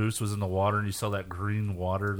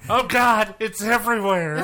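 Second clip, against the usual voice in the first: laughing panic; 11 semitones higher, 7 dB louder than usual. Pretend. Acting astronauts in a mess.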